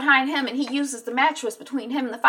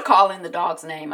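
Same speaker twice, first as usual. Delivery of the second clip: ordinary talk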